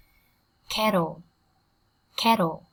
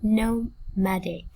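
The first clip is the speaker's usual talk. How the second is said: slow No, pause, madic; slow broken-down reading; much slower than usual. Shown as a demonstration. Teacher modelling nomadic for students, slowly in pieces. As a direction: sound out Nomadic slowly with a break inside. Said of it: "Cô giáo đọc chậm nhá".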